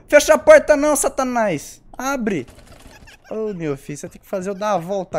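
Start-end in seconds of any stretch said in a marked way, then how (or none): 0.1-2.5 shouting. Real, not acted. Yelling at game monster.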